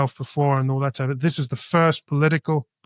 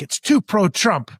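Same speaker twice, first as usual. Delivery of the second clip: higher-pitched imitation of her objection; mock retort; 5 semitones higher than usual. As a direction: fire it back as the objecting woman. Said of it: "she shoots back".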